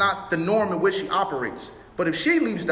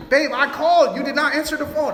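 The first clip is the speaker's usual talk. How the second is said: raised, louder mock complaint to the wife; exasperated retort; 7 semitones higher, 4 dB louder than usual. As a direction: replay the husband snapping back, higher and louder. Retold argument with wife over shoes.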